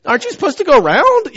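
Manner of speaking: high, loud indignant retort; indignant yell. Retold or imitated. Driver yelling at truckers stuck behind him, indignant. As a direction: yelp it way up high in disbelief.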